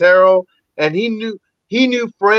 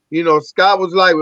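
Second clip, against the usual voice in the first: ordinary talk, nothing marked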